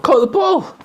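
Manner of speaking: very high, loud celebration; jubilant cheer. Acted. Parent celebrating child's catch of the ball, jubilant.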